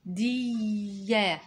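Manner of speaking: جندية drawn out very slowly; slow dictation. Shown as a demonstration. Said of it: "اكتب معايا". (جندية ببطء للإملاء)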